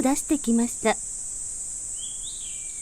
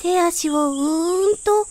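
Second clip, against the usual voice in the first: higher little voice stretching awake; a flower root yawning itself awake, voiced higher; sleepy root waking; 6 semitones higher than usual. A narrator's reading. Flower root waking in spring, higher stretching voice. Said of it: "そんなことを言いました".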